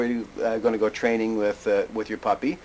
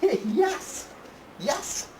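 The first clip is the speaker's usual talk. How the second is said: laughing praise. Real, not acted.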